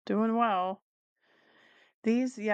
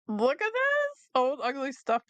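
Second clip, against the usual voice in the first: mock amazed squeal; 7 semitones higher than usual. Real, not acted. Showing viewers the ugly bear listing, high amused squeal. Rereading the funny listing title.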